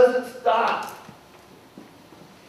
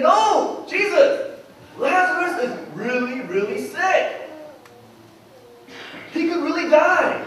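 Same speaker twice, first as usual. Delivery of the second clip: high, insistent objection; raised-pitch, worried correction; urgent protest; 8 semitones higher than usual. Retold or imitated. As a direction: plead that he has it wrong, pitched up. Disciples protest to Jesus in retelling.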